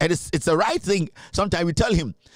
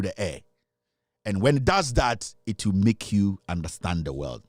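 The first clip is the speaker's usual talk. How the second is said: mock meditation; 4 semitones lower than usual. Pundit coaxing uptight rival fans in a mock meditation. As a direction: guide the fans into a pretend calm.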